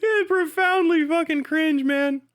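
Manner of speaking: ordinary delivery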